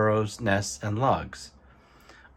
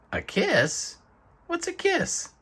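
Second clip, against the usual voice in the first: put-on like a child hearing a new word; puzzled wonder; slower than usual. Porcupine puzzled by a kiss.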